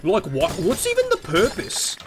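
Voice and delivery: high, swooping put-on whine; mock baffled YouTuber. Mocking critics of the level design, high baffled whine. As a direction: ask in exaggerated disbelief. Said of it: "when I talk like Half-Life two voice".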